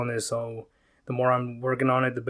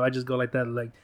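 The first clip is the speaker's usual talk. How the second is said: usual speaking voice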